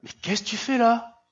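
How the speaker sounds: laughing, then a very high amazed question; God teasing his hiding prophet; high mock incredulous question; God's amused astonishment. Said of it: "Dieu lui répète".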